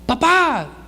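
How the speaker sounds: hammed-up kid calling out; imitated child's yell. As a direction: holler for dad like a small boy. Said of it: "porque está gritando".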